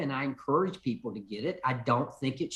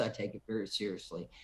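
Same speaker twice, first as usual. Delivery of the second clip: dropped low and soft, quicker, for weight; quiet, lower repeat; 7 semitones lower, 9 dB quieter, faster than usual. Shown as a demonstration. Congressman repeating his point to a caller, low and soft. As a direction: say it again under your breath, quickly. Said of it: "As a member of Congress".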